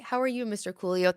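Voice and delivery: normal talking voice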